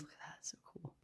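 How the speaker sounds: very quiet whisper; hushed, voiceless aside. Real, not acted. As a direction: whisper it to yourself in admiration. Admiring aside about the cards.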